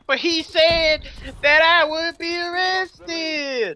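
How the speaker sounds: high, slow, drawn-out mock whine; hammed-up protest. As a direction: protest in a long high wail. Acting out protesting arrested man.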